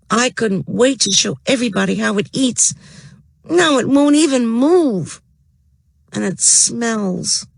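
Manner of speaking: put-on high, upset girl's voice; a frustrated kid complaining; Judy voice. A narrator's reading. Judy's voice in the story.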